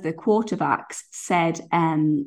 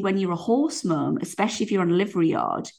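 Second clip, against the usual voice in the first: ordinary talk, nothing marked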